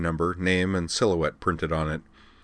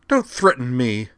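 louder, higher, slow angry retort; flaring up; 6 semitones higher, 5 dB louder than usual. Acted. Liza snaps back at Amanda, flaring angrily. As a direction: flare back at her. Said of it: "Liza flared".

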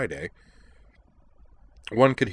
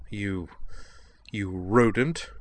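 haughty; slower than usual. Acted. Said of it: "she said haughtily".

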